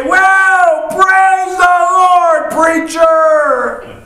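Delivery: high-pitched drawn-out holler of praise; excited church matriarch. Retold or imitated. Old church aunt moved by a blessing, mock ecstatic holler. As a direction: wail it out slowly, way up high, hammed-up. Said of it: "she would stand up and she would say".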